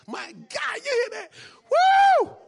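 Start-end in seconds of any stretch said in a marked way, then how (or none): 1.7-2.4 ecstatic whoop. Real, not acted. Preacher's ecstatic cry.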